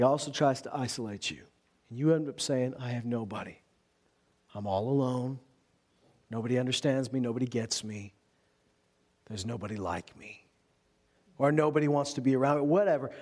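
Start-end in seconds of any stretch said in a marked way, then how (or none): 2.8-10.4 low, softening self-pity; mock dejection. A discouraged believer saying what isolation tells him, dejected. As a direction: sink lower and quieter, as if all alone.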